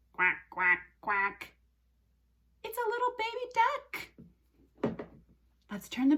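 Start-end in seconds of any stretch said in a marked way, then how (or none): 0.1-1.5 short, separated quacks; duck quack. Shown as a demonstration. Teacher shows her toy duck, quacking. 2.6-5.1 very high little voice; baby-talk coo. Pretend. Teacher shows children a baby duck, high coo. Cooing over toy duck.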